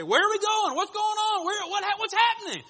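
put-on high, loud, alarmed kids' voices; kids asking. Kids in back seat demand where dad is going, high and alarmed. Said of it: "perk up and pay attention and say".

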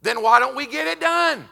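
loud, high, urgent sermon; preaching at full force. Acted. Preacher exhorting his congregation loudly, at full force. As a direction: drive it home to the pews.

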